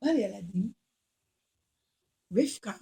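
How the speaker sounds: plain reading voice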